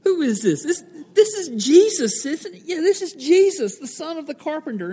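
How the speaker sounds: high, mocking disbelief; scoffing townsfolk. Hometown crowd scoffing at Jesus, high mocking voice. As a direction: sneer like a doubting neighbour. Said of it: "they said".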